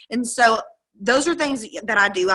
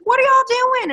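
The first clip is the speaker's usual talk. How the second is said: much higher, louder bubbly chirp; sweet bubbly waitress; 13 semitones higher, 4 dB louder than usual. Retold or imitated. Cracker Barrel waitress greeting the couple, high bubbly chirp. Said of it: "The girl was so sweet and bubbly".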